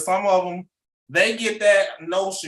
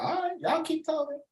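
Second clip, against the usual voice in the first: high, dismissive coach voice, much higher and softer; a coach shrugging off the chatter, higher and softer; mock coach brushing off critics; 11 semitones higher, 7 dB quieter than usual. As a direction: wave off the doubters in a put-on voice. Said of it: "He be like".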